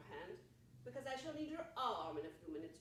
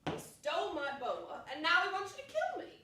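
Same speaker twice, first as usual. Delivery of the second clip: rising to a loud, higher, faster cry; indignant outburst; 6 semitones higher, 10 dB louder than usual. Acted. Old lady accusing the prospector, rising indignant outburst. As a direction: work yourself into outrage.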